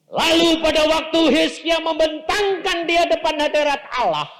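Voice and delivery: high, loud sermon shout; full-force preaching. Real, not acted. Preacher telling of Hezekiah before God, full-force preaching. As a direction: proclaim it at the top of your voice.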